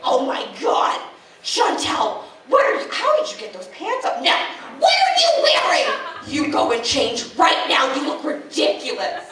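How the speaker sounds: high, shouted, scandalised mom; mock mom scolding. Mother scolding her at the family wedding, shrill mock shout. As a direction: shriek like a mortified mother. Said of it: "She runs up to me like".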